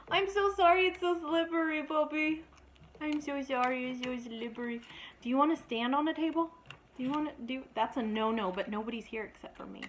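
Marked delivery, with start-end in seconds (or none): 0.1-4.8 high, cooing apology. Real, not acted. Owner apologizing to her slipping dog, high cooing voice. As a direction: soothe the pup as he slips.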